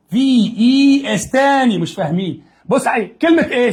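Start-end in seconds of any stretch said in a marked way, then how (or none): 0.1-1.8 very slow, drawn-out letters; slow spelling out. Shown as a demonstration. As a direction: stretch each letter for the kids. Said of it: "احط مكانها ايه".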